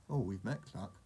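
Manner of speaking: ordinary delivery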